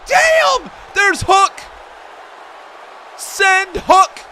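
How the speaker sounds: high, loud fan yell; excited shout. Real, not acted. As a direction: hype up the wrestler's entrance.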